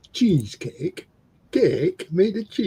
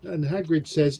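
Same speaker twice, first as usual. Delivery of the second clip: usual speaking voice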